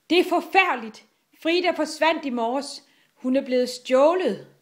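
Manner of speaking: high, loud cry of alarm; alarmed father. A narrator's reading. Frida's father reports her stolen, alarmed. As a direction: raise the alarm in distress. Distraught father brings news of kidnapping.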